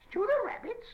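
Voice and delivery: high, loud dismayed squeal. Acted. Gollum squeals at Sam stewing the rabbits. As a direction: squeal out in horror. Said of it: "squealed Gollum in dismay".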